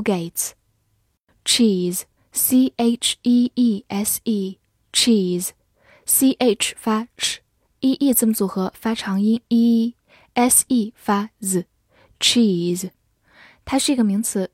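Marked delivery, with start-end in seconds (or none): 1.4-12.9 cheese sounded out letter by letter, slowly; slow spelling drill. Shown as a demonstration. Teacher sounding out cheese slowly.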